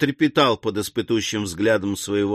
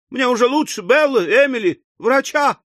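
high-pitched spinster aunt working up to a fit; rising theatrical hysteria; hysterical; 14 semitones higher, 4 dB louder than usual. A narrator's reading. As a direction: gasp out the questions one by one, ever higher. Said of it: "начался припадок номер второй истерического смеха".

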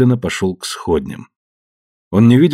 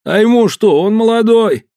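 whiny complaint; 13 semitones higher, 4 dB louder than usual. Acted. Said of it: "жаловался он".

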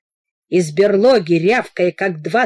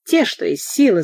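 plain reading voice

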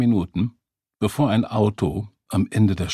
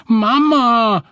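long, high, loud wail; calling out; 9 dB louder than usual. A narrator's reading. Girl crying out to her mother, loud. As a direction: cry out, holding it high. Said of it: "rief das Mädchen".

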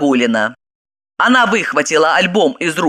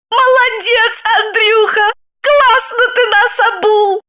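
high gleeful praise; delighted wife. Acted. Wife kisses the photo and praises her ex, high glee. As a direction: cheer him on.

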